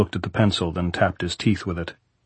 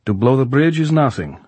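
raised commanding general's voice; soviet soldier; 5 semitones higher, 5 dB louder than usual. Acted. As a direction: bark it like a general. Goltz briefs Jordan over map.

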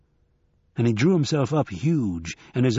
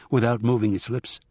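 ordinary delivery

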